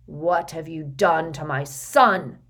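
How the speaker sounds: slow menacing growl; growled warning. Acted. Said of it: "in a menacing growl".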